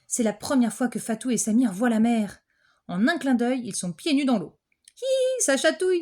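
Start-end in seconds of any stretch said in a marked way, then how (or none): 5.0-6.0 put-on high, squealing tickled girl; giggly child. Fatou paddles in the sea, high squeal.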